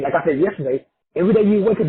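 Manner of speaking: ordinary talk, nothing marked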